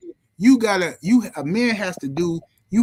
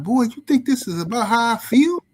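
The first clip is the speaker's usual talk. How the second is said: high-pitched indignant retort; snapping father; 7 semitones higher than usual. Retold or imitated. Sick father answering his concerned son, snappish. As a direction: imitate a dad snapping at his boy. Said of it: "he snapped on me".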